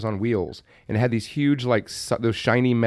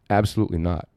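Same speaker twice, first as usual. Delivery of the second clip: deadpan De Niro; 5 semitones lower, faster than usual. Retold or imitated. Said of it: "And he looks at me and says".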